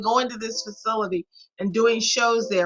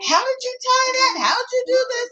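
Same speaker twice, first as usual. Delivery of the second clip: curious admirers; 13 semitones higher than usual. Retold or imitated. Imagined admirers asking about outfit.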